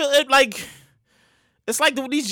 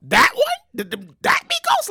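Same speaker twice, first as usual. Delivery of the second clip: shocked disbelief; slower than usual. Retold or imitated. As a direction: squeak out the shock. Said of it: "It was just like".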